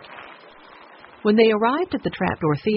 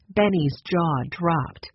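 usual speaking voice

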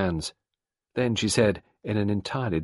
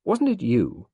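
pointed, higher-pitched challenge; accusing question, slightly raised; demanding; 5 semitones higher, 4 dB louder, slower than usual. A narrator's reading. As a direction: demand an answer. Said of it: "Sarah demanded".

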